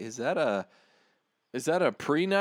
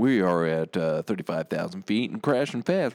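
mock captain announcement; 9 semitones lower than usual. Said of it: "he's like".

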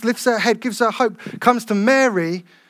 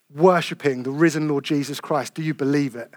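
ordinary talk, nothing marked

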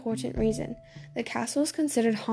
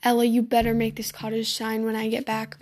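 ordinary delivery, nothing marked

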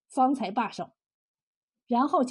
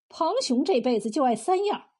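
ordinary delivery, nothing marked

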